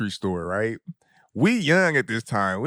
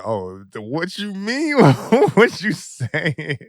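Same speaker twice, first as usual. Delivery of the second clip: mock playing dumb; 6 semitones higher, 4 dB louder, slower than usual. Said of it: "I was like".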